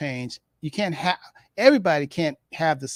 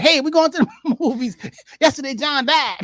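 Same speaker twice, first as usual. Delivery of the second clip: much higher and louder, laughing breezy tone; inappropriately cheerful; 8 semitones higher, 6 dB louder than usual. Pretend. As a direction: chirp it like nothing happened. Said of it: "You're not supposed to be like".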